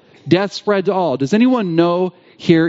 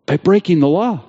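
ordinary delivery

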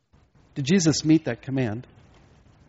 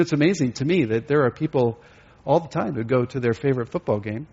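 normal talking voice

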